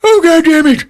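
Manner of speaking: very high, loud outburst; mock exasperated cry. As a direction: shriek it in comic frustration. Finn reacts to her collapse.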